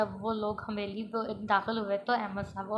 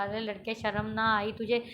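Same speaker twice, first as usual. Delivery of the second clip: usual speaking voice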